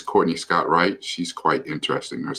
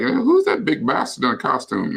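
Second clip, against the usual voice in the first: higher-pitched imagined heckler; mock heckler; 9 semitones higher than usual. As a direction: lightly voice a nosy onlooker, pitched up. Voices an imagined onlooker mocking his costume.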